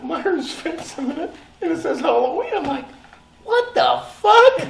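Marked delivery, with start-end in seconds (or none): 3.4-4.6 high, slow, incredulous what the fuck; stunned disbelief. Retold or imitated. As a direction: gasp it out in disbelief on seeing the billboard. Shocked at seeing billboard.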